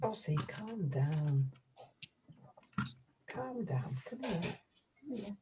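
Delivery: soothing a pet. Real, not acted. Soothing the turkey.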